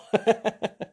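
high burst of laughter; laughing. Real, not acted. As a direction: break into a laugh. Laughing at own joke.

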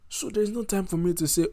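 heated protest. Real, not acted. Activist refusing calls to stay peaceful, loud and heated. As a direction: raise the voice in impassioned refusal.

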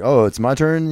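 mock eager drummer. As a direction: jump in like the drummer. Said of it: "it's like".

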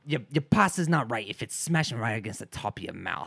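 mock Italian accent drifting into Irish; wobbly Italian-Irish accent; Italian turning Irish. Shown as a demonstration. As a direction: start Italian, slip helplessly into Irish. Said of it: "it'll always end Irish".

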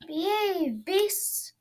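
high stern command; shouting command. Acted. Princess commanding the monster, shouting. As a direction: order the beasts to behave. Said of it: "she shouted".

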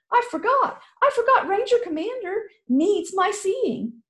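put-on high, breathless girl in a rush; young girl who forgot her duty; panicked girl. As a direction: blurt it out as she runs.

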